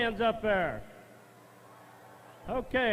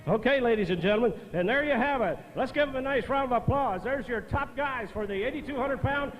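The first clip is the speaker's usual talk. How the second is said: tractor pull announcer; 4 semitones higher than usual. Real, not acted. Announcer hypes crowd, calls applause.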